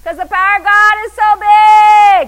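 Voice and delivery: loud, high, stretched proclamation; exultant shout. Real, not acted. Preacher proclaiming God's power to the congregation, exultant shout. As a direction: belt it out in triumph. Exultant climax of laughing praise.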